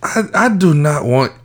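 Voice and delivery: lightly voiced African guy, mock indignant; African accent. Podcast host jokes to cohost in a light African accent. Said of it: "African voice".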